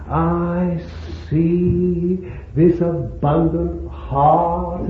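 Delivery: slow declarations swelling to loud, high cries; declamatory preaching. Acted. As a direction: preach with drawn-out stresses, building in force. Preaching at full force.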